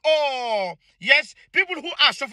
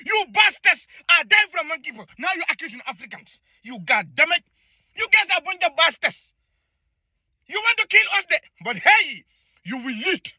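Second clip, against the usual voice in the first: normal talking voice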